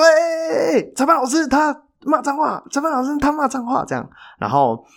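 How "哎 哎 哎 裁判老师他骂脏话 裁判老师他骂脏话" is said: high, sing-song tattletale call; tattling fans. Retold or imitated. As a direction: rat him out to the ref like a gleeful kid. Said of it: "周遭的球迷就说".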